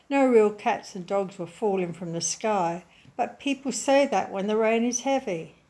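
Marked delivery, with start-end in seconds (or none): none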